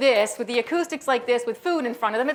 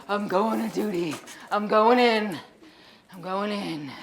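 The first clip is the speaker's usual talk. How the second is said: mock brave soldier; 4 semitones lower, slower than usual. Mock heading into battle.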